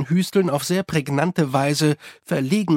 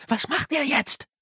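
much higher, urgent question; anxious repeat; 11 semitones higher than usual. A narrator's reading. Susan watches from behind the cart, anxious. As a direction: press the question, pitched right up.

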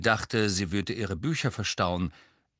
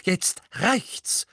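firm, higher-pitched declaration; determined; 8 semitones higher than usual. A narrator's reading. Hermine confronting Fred and George, determined. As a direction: put your foot down. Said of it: "sagte Hermine entschlossen".

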